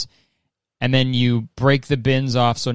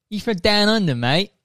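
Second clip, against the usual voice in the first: put-on Australian accent; 8 semitones higher than usual. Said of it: "I can't even do an Australian accent".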